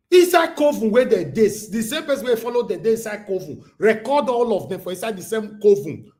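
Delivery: high and loud, still fired up; loud heated rant. Real, not acted. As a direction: keep shouting the complaint.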